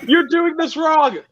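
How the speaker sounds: high, drawn-out scolding voice; mock nagging advisor. Acting out himself giving unwanted advice.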